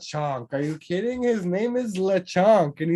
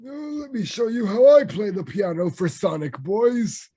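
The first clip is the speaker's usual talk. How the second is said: hammed-up old grandpa, pitched higher; 4 semitones higher than usual. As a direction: put on a doting old grandpa voice. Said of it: "Grandpa's piano in the background".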